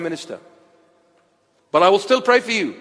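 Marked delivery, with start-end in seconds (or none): 1.7-2.7 shouted pledge of love and warning; preaching shout. Real, not acted. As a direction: raise your voice but keep the love in it. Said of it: "even if I'm shouting".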